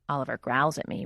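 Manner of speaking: ordinary delivery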